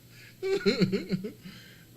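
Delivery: high, loud burst of laughter. Real, not acted. Streamer still laughing at his own airline joke, high laugh. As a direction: crack up again.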